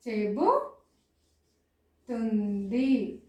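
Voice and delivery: చెబుతుంది as చెబు, long pause, తుంది; very slow, broken చెబుతుంది; syllable by syllable. Shown as a demonstration. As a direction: dictate చెబుతుంది in slow pieces. Teacher splits word into syllables.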